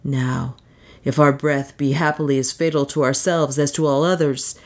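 ordinary delivery, nothing marked

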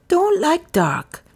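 high, slow plea from a frightened butler; pleading. A narrator's reading. Butler explains his fear of the dark, pleading. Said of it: "he asked with a pleading expression".

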